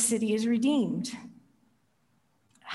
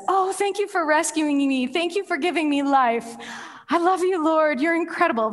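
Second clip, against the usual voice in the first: high, effusive mock thanks; hammed-up adoring prayer; gushing prayer; 6 semitones higher, 4 dB louder than usual. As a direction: pile on the praise, sorry-not-sorry. Jonah's gushing sorry-not-sorry prayer.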